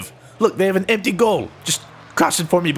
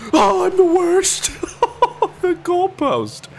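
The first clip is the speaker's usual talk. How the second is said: high laughing wail at himself; laughing despair; slower than usual. Real, not acted. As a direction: laugh through the groan. Laughing at own miss.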